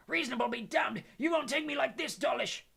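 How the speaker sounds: defiant roar, high and loud; Hagrid. A narrator's reading. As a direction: roar back at your attackers. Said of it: "And Hagrid roared".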